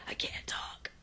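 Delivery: winded whisper, barely any sound; voiceless mock croak. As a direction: imitate someone with the wind knocked out, whispering. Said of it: "he kind of has no voice".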